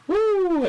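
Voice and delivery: high cheering woo; excited woo. Real, not acted. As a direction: whoop for the entrance. Cheering wrestlers entering.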